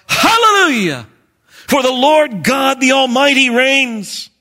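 high, loud shout of hallelujah; crying out praise. A narrator's reading. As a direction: cry it out like a great multitude. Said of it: "crying out".

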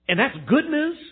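high, loud mock disbelief; hammed-up sceptical query; incredulous question. Acted listener's disbelief at pastor.

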